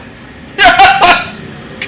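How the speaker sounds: a bright burst of real laughter; real delighted laugh. Real, not acted. Telling friends about meeting Ralph Sampson, delighted laugh. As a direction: laugh out loud, thrilled to have met him.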